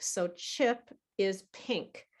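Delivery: ordinary talk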